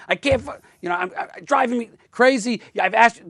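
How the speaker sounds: exasperated outburst at a partner who won't listen. Retold or imitated. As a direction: let the anger show so she gets it. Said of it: "start acting more angry".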